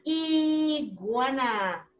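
iguana with a long held "i", slowly stretched; drawn-out word sounding. Shown as a demonstration. Teacher naming the drawing for her pupils, drawn-out word sounding. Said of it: "Iguana empieza con I".

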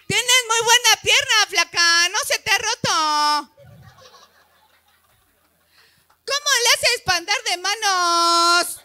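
más agudo y fuerte, burlón; burla gritada. Retold or imitated. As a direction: imita la burla con risa. Burlas de la gente. (burla en voz alta con una risa)

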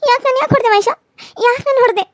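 high, loud wailing protest; tearful outcry. Acted. Wife wails at husband who hit her.

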